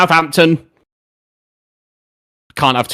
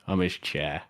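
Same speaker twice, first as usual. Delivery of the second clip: much softer, muttered I'm his chair; quiet repeat; 9 semitones lower, 15 dB quieter than usual. Shown as a demonstration. Streamer repeats the chat message to himself, much softer.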